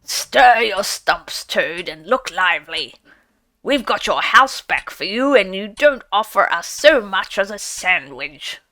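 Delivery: gruff badger. A narrator's reading. Badger chiding Toad to fetch supper, lightly gruff. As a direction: nudge Toad along, half grumbling.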